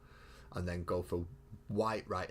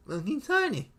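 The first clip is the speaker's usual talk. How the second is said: much higher and louder squeak; squeaky tiny voice; 8 dB louder than usual. Pretend.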